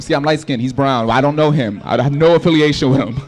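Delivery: normal talking voice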